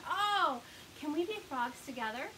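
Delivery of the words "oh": ordinary delivery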